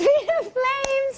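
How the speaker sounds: very high, slow, drawn-out mock title; mock announcer echo. Co-host echoing the joke show title, mock announcer. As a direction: echo the title in a soaring, stretched voice.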